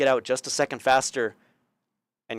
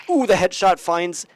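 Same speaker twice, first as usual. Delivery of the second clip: higher, louder hyped play call; excited commentary; 6 semitones higher, 6 dB louder than usual. Real, not acted. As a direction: ride the highlight moment. Caster hyped by headshot.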